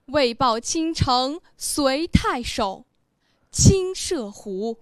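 loud, proud declamation; 得意的朗诵. Shown as a demonstration. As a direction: recite boldly and pleased with yourself, projecting each phrase. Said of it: "你是不是很得意呀". (得意、豪放地大声朗诵)

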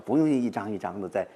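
ordinary talk, nothing marked

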